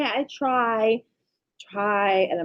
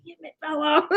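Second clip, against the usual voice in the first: laughing scold; 6 semitones higher than usual. Retold or imitated. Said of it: "I said".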